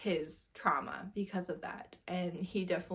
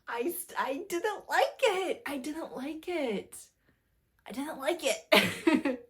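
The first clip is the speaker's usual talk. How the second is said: exasperated repetition; 7 semitones higher, 4 dB louder, slower than usual. Real, not acted. Repeating a complaint about a book.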